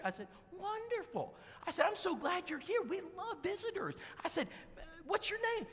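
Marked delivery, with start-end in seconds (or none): 0.5-3.9 high, sugary church-greeter welcome; gushing welcome. Retold or imitated. 4.8-5.7 high, sugary church-greeter welcome; gushing welcome. Retold or imitated.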